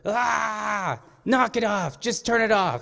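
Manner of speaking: high, loud cry to stop; acted-out holler of alarm; panicked yell. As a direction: imitate shouting over a grinding gearbox. Grandfather yelling after wrong gear.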